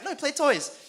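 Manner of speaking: put-on high babyish whine; toddler voice. Dad imitating his son refusing to go out, high babyish whine. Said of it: "He's like".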